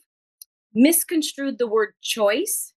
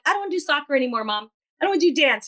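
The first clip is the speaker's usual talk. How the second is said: put-on fast, higher whiny child; five-year-old kid; 5 semitones higher, much faster than usual. Five-year-old quitting soccer to mom, fast childish whine. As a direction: rattle it off like a sulky kid to mom. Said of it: "like a five-year-old kid".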